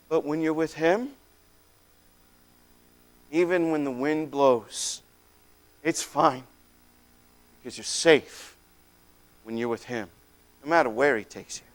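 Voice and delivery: very soft, low and unhurried; tender reassurance. Real, not acted. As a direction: hush down into quiet comfort. Closing reassurance after storm story.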